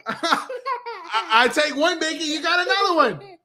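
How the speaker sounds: talking through laughter; dad laughing at his son's joke. Real, not acted. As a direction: laugh while you get the words out. Laughing at son.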